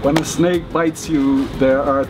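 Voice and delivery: ordinary talk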